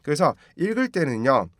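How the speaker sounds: ordinary talk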